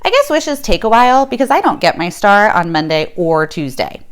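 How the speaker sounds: ordinary delivery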